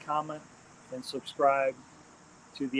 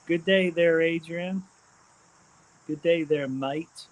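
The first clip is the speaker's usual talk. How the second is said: put-on Australian accent; 3 semitones higher than usual. Streamer greeting a viewer from Australia, imitated Australian accent. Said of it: "trying to talk like this way".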